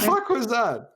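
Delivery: high-pitched incredulous outburst; amused disbelief. Real, not acted. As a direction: squeal it in disbelief at the mimicry. Reacting to co-host's odd imitation.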